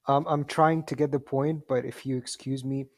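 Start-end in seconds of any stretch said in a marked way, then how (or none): none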